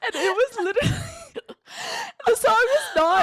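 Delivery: high laughing tone. Real, not acted. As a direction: laugh through the words. Laughing while telling.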